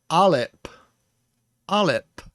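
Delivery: "alep" com p plosivo, explosivo; plosive p sound. Shown as a demonstration. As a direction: estoure o p em "alep". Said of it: "without the plosive sound".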